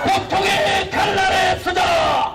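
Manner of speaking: high, slow, booming battle cry; roaring rallying speech. Real, not acted. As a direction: rouse the crowd for the final fight. Closing rally chant.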